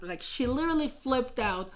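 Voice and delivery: ordinary talk, nothing marked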